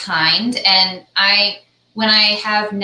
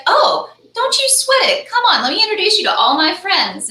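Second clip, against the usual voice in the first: much higher, reassuring; kind and sweet; 12 semitones higher, 3 dB louder than usual. Retold or imitated. Garrick reassuring a nervous newcomer, high, lightly sweet. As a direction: soothe a nervous newcomer kindly. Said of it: "he was so kind and so sweet".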